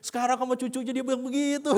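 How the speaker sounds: hammed-up jealous protest; mock indignation. Grown child sees grandparents indulge the grandchild, mock indignation.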